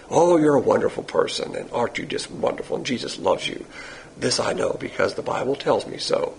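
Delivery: soft, low, mock-sweet flattery; mock ego-stroking. Churches stroking people's egos, softly mocking their flattery. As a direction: murmur it like insincere church flattery. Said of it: "they stroke people".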